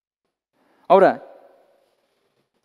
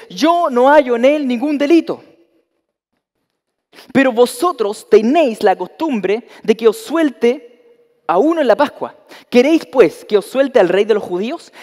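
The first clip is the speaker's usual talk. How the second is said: loud, higher public proclamation; Pilate to the crowd; 5 semitones higher, 6 dB louder, slower than usual. Acted. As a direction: call it out to the whole crowd.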